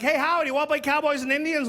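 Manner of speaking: lightly voiced, high kid voice; childhood friends. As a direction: imitate kids calling a pal to play. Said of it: "All my friends like".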